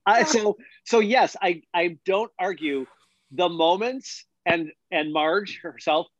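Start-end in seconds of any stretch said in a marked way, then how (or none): none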